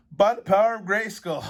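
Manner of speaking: "By the power of Grayskull" yelled high and loud; shouting. Shown as a demonstration. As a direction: shout "power" like a heroic battle cry. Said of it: "you can also yell".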